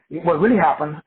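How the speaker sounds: usual speaking voice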